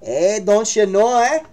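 put-on joking Canadian accent, drawn out slowly; mock Canadian. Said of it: "You're a Canadian cat?".

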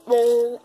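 loud disgusted groan; loud ugh of disgust. Real, not acted. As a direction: groan at the awful taste. Bad taste still in mouth.

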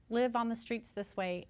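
ordinary talk

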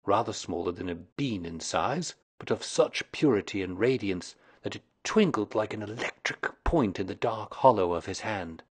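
plain reading voice

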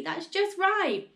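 high, drawn-out plea; slow, stretched high cry; urgent cry. A narrator's reading. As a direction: call out to halt it there. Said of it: "cried Missus Cranky".

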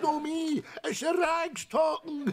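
stoned shout. Acted.

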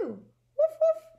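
loud high yappy puppy woof; dog sounds. Acted. Teacher voicing Biscuit for the class, loud high bark. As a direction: bark it out like an excited little dog. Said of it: "all of my dog sounds".

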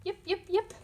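high, sharp yipping fox; high fox yips. Acted. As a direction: yip like an excited little fox.

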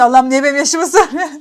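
loud mock plea breaking into laughter; laughing disbelief. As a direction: retell your bafflement while laughing. Şaşkınlığını gülerek canlandırıyor. (gülerek, yüksek sesle şaşırmış gibi)

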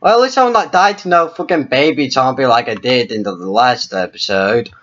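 ordinary talk

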